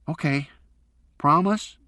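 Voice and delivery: put-on high child's voice, pleading; tearful boy. A narrator's reading. Son tearful on the phone, asking his father to promise. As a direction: make him promise.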